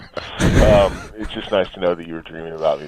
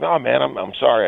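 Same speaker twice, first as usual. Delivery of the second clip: apologetic; 7 semitones higher than usual. Retold or imitated. Acts out turning down the invitation.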